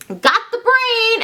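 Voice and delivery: high, drawn-out announcement; eager Igor. Acted. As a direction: call it out like a proud henchman. Acting Igor delivering the brain.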